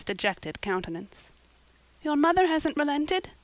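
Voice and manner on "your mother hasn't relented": high, anxious gasp of a question; high-pitched, gasped disbelief. A narrator's reading. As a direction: ask it in dismay, pitched well up. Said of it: "she gasped".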